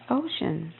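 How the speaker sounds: usual speaking voice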